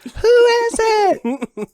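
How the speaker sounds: very high, far called-out question; calling out from crowd. Retold or imitated. Fan shouting to [name] on stage, high call-out. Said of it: "So he goes".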